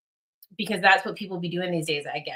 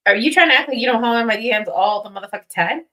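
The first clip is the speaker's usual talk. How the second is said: mock indignant; 4 semitones higher, 7 dB louder, faster than usual. Real, not acted. Teasing a friend who flirts in DMs.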